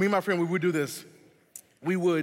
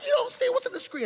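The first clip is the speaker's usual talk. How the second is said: much higher frantic pleading; panicked protest. Retold or imitated. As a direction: squeal it like a scared teen. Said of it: "And we're like".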